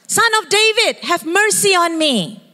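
desperate pleading shout. Retold or imitated. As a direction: cry out over the crowd. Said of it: "He shouted louder".

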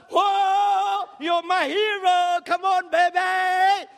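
put-on high, loud, slow drunken cheer; loud pub cheering. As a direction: whoop it up like in the pub.

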